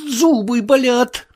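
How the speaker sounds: high, slow, strained complaint; pained voice. A narrator's reading. Marvel explaining his groans to the sailor, pained. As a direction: squeeze the words out through pain.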